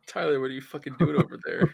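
hammed-up friends yelling through the wall; mock yell from another room. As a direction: friends shouting to crying Tyler, mock yell. Voicing friends calling from room.

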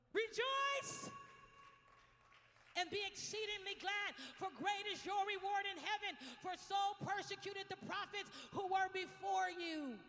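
high, loud, slow proclamation; shouted scripture. Acted. Pastor proclaiming Matthew to the church, loud and high. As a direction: ring it out in triumph.

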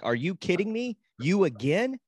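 mock the son's fury at the field; imitating an angry son, voice jumping up; angry outburst. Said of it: "because he's like".

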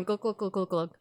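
soft mimicked glugging of a beer; quietly mime guzzling a beer down; hushed playful glug glug; gulping sounds.

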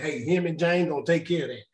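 normal talking voice

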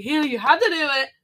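high, loud, fast insistence; pushy bratty kid. Retold or imitated. Little sister threatening Hannah with bad luck, fast bratty insistence. Pestering sister with fake curse.

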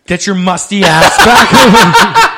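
slow, loud, laughing bark; yelling coach. Pretend. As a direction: yell it like a fed-up coach. Mock dance parent yelling.

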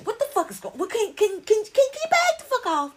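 high-pitched, stammering exasperation; exasperated demand. Acted. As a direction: sputter it out high, telling them to back off. Mock confronting those crowding her out.

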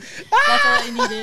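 high, loud, drawn-out laughing cry; slow, loud crow of delight; gleeful crowing. Real, not acted. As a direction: shriek it in triumph through laughter. Gleeful repeat amid the laughter.

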